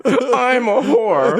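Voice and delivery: high, very slow, drawn-out wail; hammed-up glove lament. Glove at the mirror, wailing high and slow. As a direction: stretch it out like a broken cartoon. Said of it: "Put the lipstick around his mouth".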